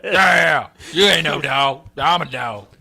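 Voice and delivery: loud yelling at dogs; drunk yelling. Retold or imitated. Champ yelling at dogs in a dog park, loud. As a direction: holler at the dogs like a drunk fan.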